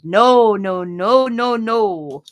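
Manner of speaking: retold loud refusal; emphatic mock veto. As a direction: cut them off with loud, imitated protests. Retold doctor vetoes her suggestion.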